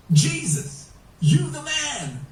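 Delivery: high-pitched, jubilant hailing; acclaiming cry. Acted. Preacher voices blind men hailing the Messiah, acclaiming cry. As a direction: call it out high, hailing a king.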